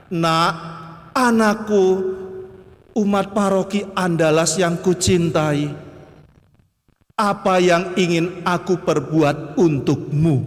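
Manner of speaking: gentle and low, like Jesus to a beggar; tender Jesus voice. Acted. Jesus gently asks the blind man what he wants, tender.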